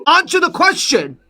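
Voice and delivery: high, insistent yell; real yelling. Real, not acted. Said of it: "Are you fucking yelling at me?".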